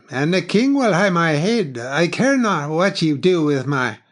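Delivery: high, loud declaimed saying; dry delivery. A narrator's reading. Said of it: "It said dryly".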